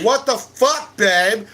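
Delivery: high, loud outraged question; mock outrage. Wife demanding answers from her husband, loud mock outrage. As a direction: play the wife's loud disbelief. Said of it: "my old lady's like".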